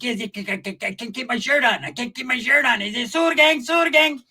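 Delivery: high, stammering, high-as-a-kite voice; high wired Pepe. Retold or imitated. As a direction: stutter out where you're from, wired. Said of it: "Hey, Pepe was like".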